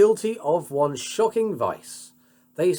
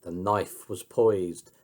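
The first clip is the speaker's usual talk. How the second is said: ordinary delivery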